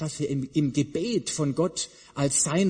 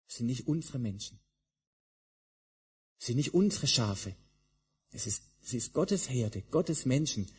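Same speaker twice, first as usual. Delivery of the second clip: earnest hush; 3 semitones lower, 5 dB quieter than usual. Real, not acted. Preacher drops to earnest hush.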